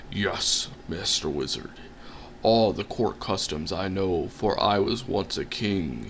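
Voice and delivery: low, soft humble troll; bow and answer humbly, low. Acted.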